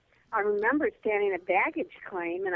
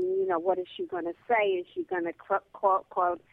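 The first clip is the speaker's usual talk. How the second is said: mock wondering; 8 semitones higher than usual. Inner thought, mocking guess.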